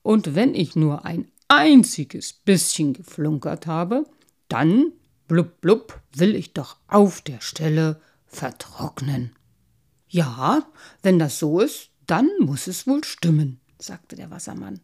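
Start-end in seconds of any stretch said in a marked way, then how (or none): 0.0-9.4 loud, emphatic carp with blubs; ponderous insistence on honesty; earnest protesting. A narrator's reading. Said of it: "beteuerte der Karpfen". 10.1-13.6 loud, firm concession; big-voiced fatherly verdict; merman father. A narrator's reading.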